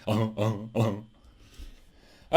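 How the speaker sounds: low, mumbled singer's catchphrase. Acted. Imitating [name] after joking about him.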